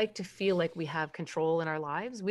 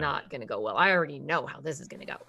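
dreading; 5 semitones lower than usual. Retold or imitated. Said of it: "you're like".

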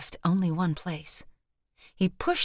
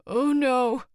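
ordinary delivery

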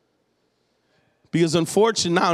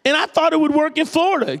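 ordinary talk, nothing marked

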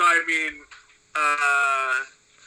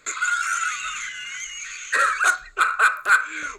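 shrieking laugh. Real, not acted. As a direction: howl with laughter. Huge laugh at joke.

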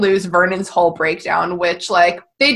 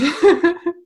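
laugh; 10 semitones higher than usual. Real, not acted. Laughing at the owl rumor.

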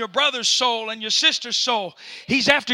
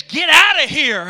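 Saul's shout; 4 semitones higher, 7 dB louder than usual. Retold or imitated. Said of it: "and says".

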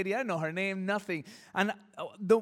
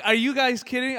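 amazed; 4 semitones higher, 7 dB louder than usual. Retold or imitated. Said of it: "I was like".

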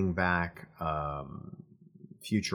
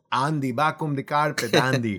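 higher, hammed-up nagging dad calling his son; imitating a father; 6 semitones higher, 4 dB louder than usual. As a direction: bark the chore like a fed-up father, pitched up. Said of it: "does an imitation of my father".

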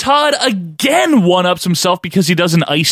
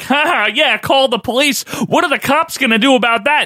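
higher, mocking sneer at calling the police; mocking Todd; 8 semitones higher than usual. Imitating Todd mocking Bobby, a higher sneer. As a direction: scoff at the idea like a smug host. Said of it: "And Todd's like".

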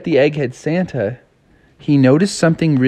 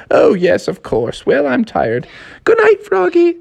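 sister voice; 11 semitones higher than usual. Acted. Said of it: "And she was like".

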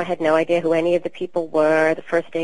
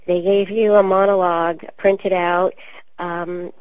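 normal talking voice

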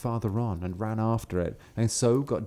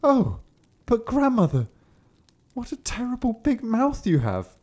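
put-on little girl voice; 12 semitones higher, 3 dB louder than usual. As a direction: gasp it out innocently. Kapturek przy łóżku.

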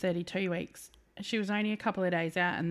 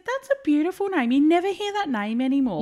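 high, louder gushing praise; mock-delighted exclaiming in a high voice; dad's delighted reaction; 8 semitones higher, 7 dB louder than usual. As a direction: imitate an impressed dad, pitched well up.

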